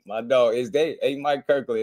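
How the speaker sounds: ordinary talk, nothing marked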